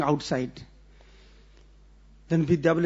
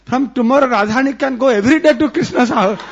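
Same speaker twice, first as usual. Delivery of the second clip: loud, higher, desperate begging; frightened old woman pleading, louder and higher; frantic pleading; 4 semitones higher, 6 dB louder than usual. Acted. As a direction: beg in a panic through the door. Frightened Jatila begging loudly.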